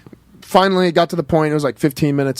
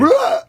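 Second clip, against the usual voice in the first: high squealing yell. Retold or imitated. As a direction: squeal it out in pain. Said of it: "He goes".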